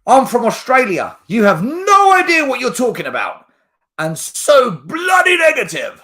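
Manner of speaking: high, loud tirade from an angry commenter; mock outraged shout. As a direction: yell it like an indignant fan having a go.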